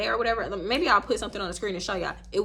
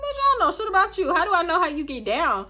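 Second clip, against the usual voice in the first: mock inner outrage; 7 semitones higher than usual. Angry inner retort.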